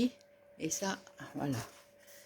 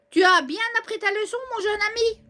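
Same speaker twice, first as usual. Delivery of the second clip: character voice; 11 semitones higher, faster than usual. Acted. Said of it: "repeated differently".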